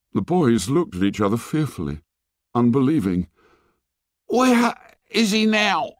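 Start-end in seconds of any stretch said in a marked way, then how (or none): 4.3-5.9 slow, very high, breathless question; gasping demand. A narrator's reading. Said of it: "he was gasping for breath".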